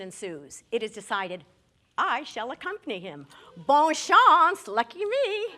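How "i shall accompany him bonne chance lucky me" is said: louder and higher, building to a sarcastic cheer; grand sarcastic announcement. Retold or imitated. Volunteering to go to Paris with Larry, rising sarcastic cheer. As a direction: announce it like a reluctant hero.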